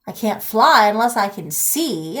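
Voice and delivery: plain reading voice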